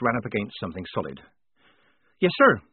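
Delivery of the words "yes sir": higher, louder, abrupt; snapped reply. A narrator's reading. Polly answering the lieutenant, snapping it out. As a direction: smart soldier's snap to attention. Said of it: "she snapped".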